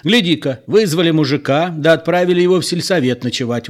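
loud, high indignant scolding; indignant old man. A narrator's reading. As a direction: tear into them, outraged. Old man angry at the women.

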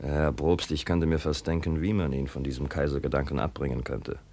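low hushed plotting; low confiding. Acted. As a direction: keep it hushed and conspiratorial. Son conspires with provost quietly.

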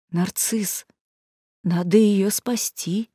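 hushed, cautious plea; hoarse whisper. A narrator's reading. Someone cautiously begs Narcissus to save her, hoarse whisper. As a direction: barely dare say it, very softly. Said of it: "хриплым шёпотом".

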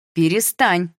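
loud, short command to stop; exasperated wife's sharp appeal; urgent plea. A narrator's reading. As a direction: cut the quarrel off. Wife again stops husband.

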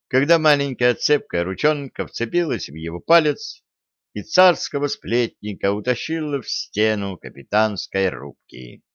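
ordinary delivery, nothing marked

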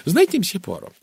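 fussy lady running on and on; chatty, flustered American lady. A narrator's reading. Narrator voices talkative Mrs Gardner.

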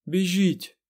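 high, loud order; commanding shout. A narrator's reading. Aes Sedai orders the others to flee, shouting. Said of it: "наказала Морейн".

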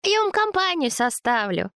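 very high, cooing flattery; babbling, fussing woman. Acted. Zina soothes angry Vova, high fawning babble. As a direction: coo and fuss to soothe him.

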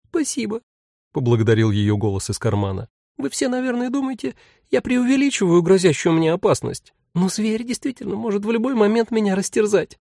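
very high, thin pleading voice; a tiny creature begging to be heard; plaintive tiny voice. Acted. Tiny demon in pocket.

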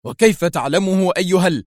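mild narrated outburst; exclaiming. A narrator's reading. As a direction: burst out, then break off. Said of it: "هتف".

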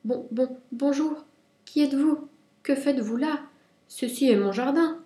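high, little rabbit voice summoning courage; tiny would-be brave voice, stammering at first; small timid voice. A narrator's reading. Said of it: "il dit d'une petite voix".

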